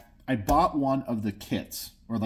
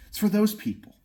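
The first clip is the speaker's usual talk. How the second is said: passionate; 5 semitones higher than usual. Real, not acted. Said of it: "if I sound passionate".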